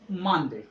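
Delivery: मानदेय with weight on मान, light देय; first-syllable stress. Shown as a demonstration. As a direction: stress मान and let देय fall away. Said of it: "इसको stress देना है".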